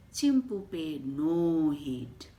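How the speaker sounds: ordinary delivery, nothing marked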